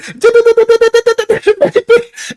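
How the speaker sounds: high laughing bird chatter; mock bird noise, laughing.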